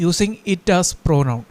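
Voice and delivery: very slow heading read word by word; slow dictation. Shown as a demonstration. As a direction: dictate the title slowly. (പതുക്കെ തലക്കെട്ട് പറഞ്ഞുകൊടുക്കുന്നു)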